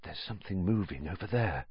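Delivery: very soft, quick undertone; hushed, urgent warning; breathed, hushed. A narrator's reading. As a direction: barely voice it so nothing hears. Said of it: "Harry breathed".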